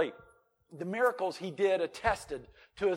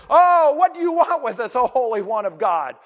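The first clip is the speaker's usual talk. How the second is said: louder, higher, strange demonic voice; 6 semitones higher, 13 dB louder than usual. Retold or imitated. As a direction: voice the demon crying out to Jesus. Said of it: "the demons would speak and say".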